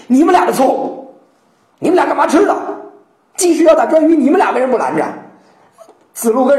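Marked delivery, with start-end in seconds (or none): 0.1-5.0 mock table-slamming rebuke; teacher scolding two students for not stopping the attack, angry; angry Confucius. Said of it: "孔子啪拍桌子就急了".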